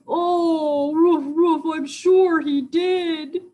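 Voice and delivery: loud, high howling dog voice; wailing cartoon dog; alarmed dog. A narrator's reading. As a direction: woof it out and stretch the last words. Dog character alarmed in story.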